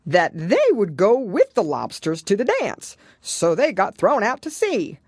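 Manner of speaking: loud, high, brisk Gryphon; a bold creature rattling off the answer. A narrator's reading. Gryphon explains.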